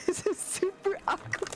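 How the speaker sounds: whispered through laughter; laughing whisper. Real, not acted. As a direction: giggle it under your breath.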